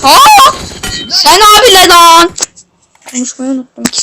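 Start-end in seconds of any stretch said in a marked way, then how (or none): 0.0-0.5 high, loud panicked yells; frantic shouts. Real, not acted. Frantic moment in fight. 1.1-2.3 slow, loud shout in the heat of the fight; loud yell. Real, not acted. Yelling mid-fight.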